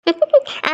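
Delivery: a quick high giggle, pleased with herself; playful laugh. Acted. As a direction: laugh teasingly at his surprise. Wife laughs about special dinner.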